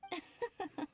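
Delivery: a short, knowing high chuckle; sly chuckle. Acted. Miko amused by their shock.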